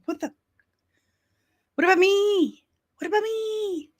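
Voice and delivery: high, plaintive whimper of the overlooked; mock jealous whine. Overlooked Filoni as fans praise Gilroy, mock whining.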